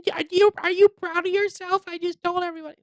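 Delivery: very high put-on voice; mocking imitation. Host imitating the journalist confronting the poster, high mock voice. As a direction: mimic an accuser in a silly high squeal.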